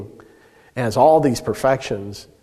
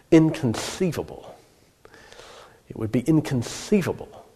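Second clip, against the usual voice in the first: Wallace Shawn's lateral lisp; 2 semitones higher than usual. Retold or imitated. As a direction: play the lisping Princess Bride villain. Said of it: "would say with his lateral lisp".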